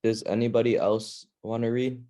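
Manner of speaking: normal talking voice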